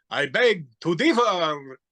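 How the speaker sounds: put-on slow, drawn-out old-horror-star delivery; lightly put-on Lugosi, savoring each word; Bela Lugosi impression. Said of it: "Bela Lugosi saying".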